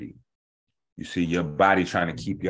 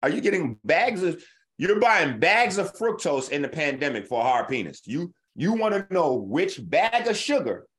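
much higher, incredulous rant; retelling his reply with outraged, pitched-up disbelief; exasperated disbelief; 9 semitones higher than usual. Retold or imitated. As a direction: shoot it up high like you can't believe they asked. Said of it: "I'm like".

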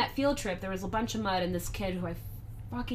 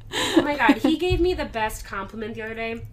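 ordinary talk

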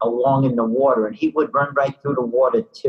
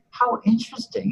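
high, polite mock English accent; imitated English accent, lifted in pitch; 9 semitones higher, 3 dB quieter than usual. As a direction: marvel in a put-on English accent. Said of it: "pardon my English accent".

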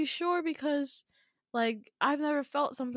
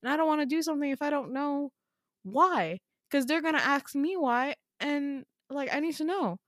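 fast, high, agitated protest; stressed refusal; 6 semitones higher than usual. Acted. Stressed child refusing dad until told why, fast high protest. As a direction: argue back in a pitched-up rush. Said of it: "you're stressing me out".

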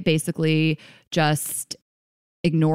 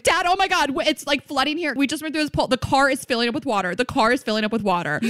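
high-pitched fake emergency, easing slightly; mock panic; 9 semitones higher than usual. Daughter pranking dad about a flooded car, mock panic. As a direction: pretend to panic down the phone to dad.

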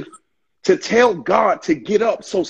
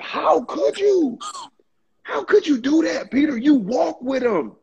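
disbelieving reproach; 9 semitones higher than usual. Retold or imitated. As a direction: ask it as if betrayed. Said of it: "we look at Peter and be like".

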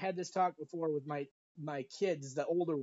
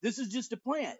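higher-pitched mock protest; mock exasperated teen; 7 semitones higher than usual. Kid repeating the excuse to dad, mock exasperated protest. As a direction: insist like a kid brushing dad off. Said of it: "push them and be like".

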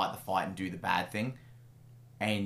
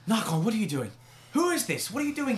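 high, startled cry, much higher; startled mother; 13 semitones higher, 4 dB louder than usual. Acted. Mother caught at her desk by her son, startled and high. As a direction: jump in fright and demand answers. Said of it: "and she goes".

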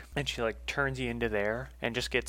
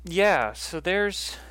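higher, drawn-out awkward admission; Martine; 6 semitones higher, much slower than usual. Acted. Martine walks back after her space jump, higher awkward drawl. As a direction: stretch it out as an awkward understatement. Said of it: "Martine turns around and starts coming back".

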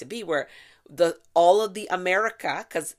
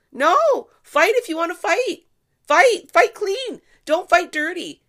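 high, loud yelled scolding; furious shout; 12 semitones higher, 9 dB louder than usual. Real, not acted. Scolding protesters who hide behind kids, a furious shout. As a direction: bellow it at them like a ref stopping a dirty fight.

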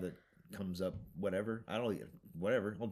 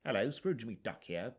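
bad British accent; 3 semitones higher, slower than usual. A weak British accent for the uncle duck, done slowly. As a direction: try a posh Brit and miss it. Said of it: "Do a British guy".

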